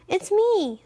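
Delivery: high, small goat voice; very little voice. A narrator's reading. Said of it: "in a very little voice".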